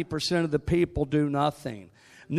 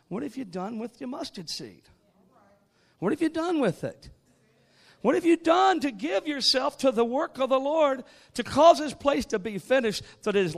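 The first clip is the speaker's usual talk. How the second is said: full-force preaching; 7 semitones higher, 4 dB louder than usual. Acted. Pastor exhorting his church, in full preaching force. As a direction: drive the point home from the pulpit.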